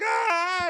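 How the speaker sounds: very high comic shriek; hammed-up yell at the top of the range; mock transformation scream. Bruce turning into Hulk, high mock scream.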